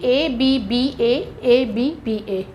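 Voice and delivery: each letter drawn out; slow letter-by-letter dictation. Shown as a demonstration. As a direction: spell the scheme out slowly for students. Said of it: "teacher spells the rhyme scheme".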